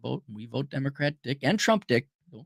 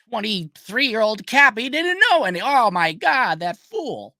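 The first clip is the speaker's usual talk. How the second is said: higher-pitched imitation of incredulous scorn; mock disbelief; 8 semitones higher, 3 dB louder than usual. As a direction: act out someone aghast at a fool. Acted mocking quote about his younger self.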